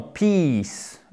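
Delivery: PEACE with a held "ee", falling; long i. Shown as a demonstration. Teacher repeating the long sound for learners, drawn out. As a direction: hold the vowel in peace. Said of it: "son long".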